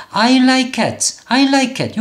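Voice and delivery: I like cat with like as one syllable; no extra vowel after like or cat; acceptable English. Shown as a demonstration. Teacher shows the part learners get right, clean English. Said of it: "여기는 괜찮아요".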